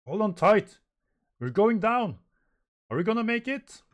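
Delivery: high, loud cries of alarm; panicked shouting. Acted. Crew shouts as the ship goes down, panicked. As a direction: shout it high over the danger.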